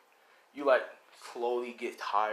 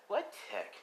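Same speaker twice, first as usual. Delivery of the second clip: high, soft innocent question; coy denial; feigned innocence; 8 semitones higher, 7 dB quieter than usual. Acted. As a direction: play dumb, pitched up. Thief feigns ignorance to pursuer.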